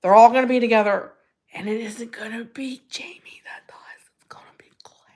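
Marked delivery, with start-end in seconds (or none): 1.5-5.1 dropping to a faint whisper; hushed whisper. Real, not acted. Confiding who won't die, fading into a whisper. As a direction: whisper it like a secret.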